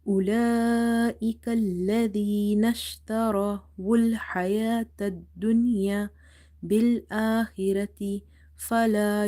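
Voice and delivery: measured chant with long held vowels; sustained, careful Quranic chanting; Quran recitation. Shown as a demonstration. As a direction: recite it in tajweed, drawing out the elongations.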